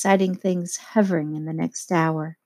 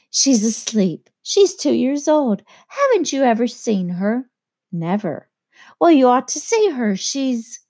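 bright Daisy; 5 semitones higher than usual. A narrator's reading.

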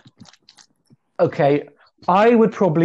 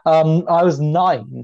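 ordinary talk, nothing marked

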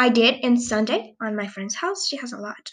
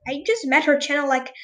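normal talking voice

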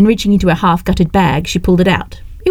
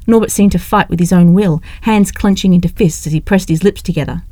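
ordinary delivery, nothing marked

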